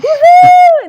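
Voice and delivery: high, loud, drawn-out woohoo; gleeful whoop. Retold or imitated. Skipping around Runyon Canyon, a gleeful high whoop. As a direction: whoop like a kid skipping along.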